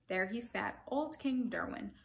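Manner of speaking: usual speaking voice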